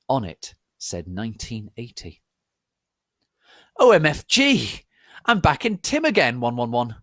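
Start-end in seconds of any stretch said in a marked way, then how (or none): 3.8-7.0 shrieking high and loud; loud scream. A narrator's reading. Narrator's heroine screams on waking in the past. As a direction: scream it out in shock. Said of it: "I screamed loudly".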